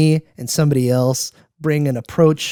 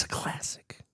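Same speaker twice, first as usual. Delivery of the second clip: whisper; 19 dB quieter than usual. Real, not acted. Whispered aside about the name.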